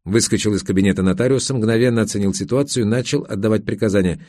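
normal talking voice

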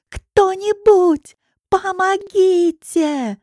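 very high, loud, very slow cry for anyone; desperate scream. Acted. Girl being forced into a car, desperately screaming for help. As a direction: wail it out, pleading. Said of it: "кричу я отчаянно".

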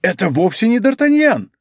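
high-pitched, astonished protest; crying out. A narrator's reading. Bonacieux sees the wrong man, crying out. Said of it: "вскричал Бонасье".

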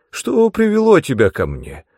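high, loud surprised question; animated old man's greeting; exclaiming. A narrator's reading. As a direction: exclaim in surprise, pitch lifted. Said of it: "воскликнул он".

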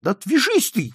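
high exasperated cry; desperate shout. A narrator's reading. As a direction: cry out to be left alone. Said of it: "в отчаянии крикнул Джонни". (отчаянный крик)

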